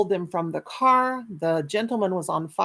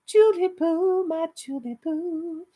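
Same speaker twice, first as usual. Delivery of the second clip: high, slow, tender crooning of the name; slow high crooning to a dear viewer; slow high coo; 10 semitones higher, slower than usual. Real, not acted. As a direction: croon her name lovingly.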